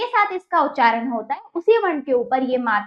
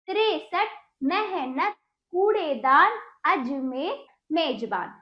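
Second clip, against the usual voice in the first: each word whole, in an even list; measured recap of whole words without breaks; joined-up reading. Shown as a demonstration. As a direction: run the syllables together, one word at a time. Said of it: "इस प्रकार हमने इसको पढ़ा, जैसे".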